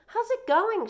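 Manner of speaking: high, bright, friendly hello; cheerful teacher greeting. Acted. Teacher arriving at the porch greets Joey, high and cheery. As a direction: greet the boy warmly from the steps, pitched high.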